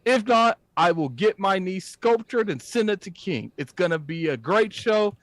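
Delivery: ordinary talk, nothing marked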